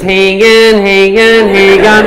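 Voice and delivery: two-tone siren chant on repeat; bogan ambulance siren. Acted. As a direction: wail it evenly like an Aussie ambulance. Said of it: "Like a Bogan ambulance".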